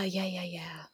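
whispered under the breath; whispered yeah. Real, not acted. Host agreeing about strong-willed kids, whispered. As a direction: breathe the words out, unvoiced.

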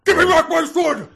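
very high, very loud shout; full-throated yell like an action-film father; movie-hero yell. Acted. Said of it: "Harrison Ford or Mel Gibson?".

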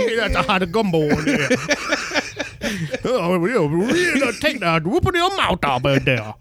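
hammed-up, garbled Creole whooping; mock Creole accent. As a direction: play a Creole diner hollering over hot gumbo. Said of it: "That's just the Creole accent".

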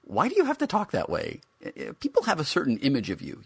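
be taken aback by the toy's language; shocked protest. A narrator's reading.

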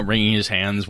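usual speaking voice

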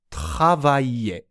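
travailler said slowly as "tra-va-ye", falling; model pronunciation. Shown as a demonstration. Teacher modelling the finished word for learners, travailler as slow "tra-va-ye". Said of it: "このように発音します".

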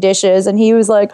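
usual speaking voice

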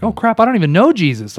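loud, high mock alarm; retold dismay. Man realising he doesn't know Jesus, retold dismay. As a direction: blurt it as a sudden, dawning realisation. Acting out his sudden realisation.

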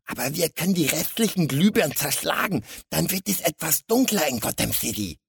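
high-pitched scheming sidekick; eager little villain. A narrator's reading. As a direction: chime in like the little crook. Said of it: "der Kleine zustimmend und ergänzend". (kleiner Schurke, hohe Stimme)

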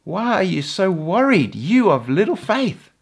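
a touch of a woken, irritated teacher; annoyed Jesus. Acted. Jesus rebuking his frightened disciples, mild annoyed rebuke. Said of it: "He's like".

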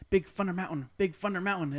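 "Thunder" said as "Funder", th turned to f; little cousin's lisp, "Big Funder Mountain"; imitating a child's lisp. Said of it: "talked with a lisp".

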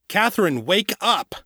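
high, urgent command; insistent high-pitched wake-up call; loud voice. A narrator's reading. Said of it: "the loud voice said".

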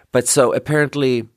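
ordinary delivery

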